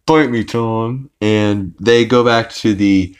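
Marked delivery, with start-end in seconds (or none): 0.1-1.1 higher, tight-jawed mock retort; through the teeth. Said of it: "through their teeth".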